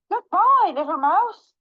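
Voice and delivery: high, loud hasty goodbye; hammed-up fleeing panic; panicked farewell. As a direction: call out as you run off. Fox hurriedly flees.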